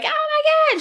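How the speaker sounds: high, shocked Oh my God; shocked gasp. Retold or imitated. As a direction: squeal it in sudden shock. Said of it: "then she's like".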